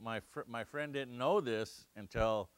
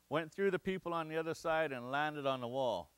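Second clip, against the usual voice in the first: ordinary talk, nothing marked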